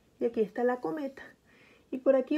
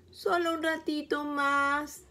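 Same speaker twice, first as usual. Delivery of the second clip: softer, slow little plea; pleading; 4 semitones higher, 4 dB quieter, slower than usual. Acted. Burrito begs his mother to stay longer, soft slow plea. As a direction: beg sweetly for a bit more time. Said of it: "suplica Burrito".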